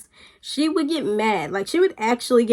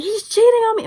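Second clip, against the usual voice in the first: much higher imitation of an upset friend; retold distraught complaint; 11 semitones higher, 4 dB louder than usual. Friend bursting in about a crush, mock-distraught. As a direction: mimic her worked-up accusation, pitched high.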